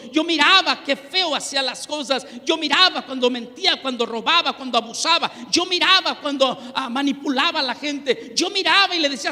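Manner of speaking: a fiery pulpit testimony; fervent preaching. Acted. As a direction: preach it out with full force. Testimony preached at full force.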